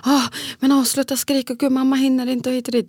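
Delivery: imitated frazzled yelling. Stressed mother telling children to stop screaming, mock exasperated. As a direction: act out snapping at the kids, flustered. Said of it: "som en galen kvinna och skriker".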